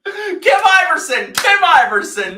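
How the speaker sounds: loud indignant correction; shouting. Real, not acted. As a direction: yell the name over them. Shouting over friend's teasing.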